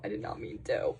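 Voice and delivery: low whispered apology; guilty whisper. Real, not acted.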